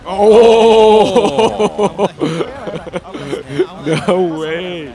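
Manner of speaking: loud, drawn-out cry of disbelief with laughter; shocked outburst. Real, not acted. Commentator watching a monster land a huge hit, loud disbelieving shout. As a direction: yell out in real shock, laughing.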